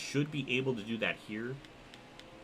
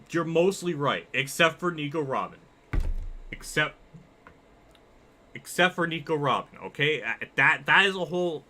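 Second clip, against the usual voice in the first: insistent, raised-voice correction; emphatic objection; 5 semitones higher, 5 dB louder than usual. Real, not acted. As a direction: push back firmly, higher and louder. Heated defence of favorite character.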